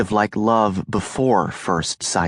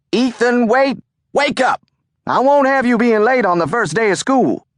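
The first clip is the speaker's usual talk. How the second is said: much higher, louder housekeeper calling upstairs; Amma calling; 5 dB louder than usual. A narrator's reading. Amma shouts up from downstairs to wake Ethan for school. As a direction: call him awake from downstairs, firm and brisk. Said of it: "I could hear Amma's voice calling".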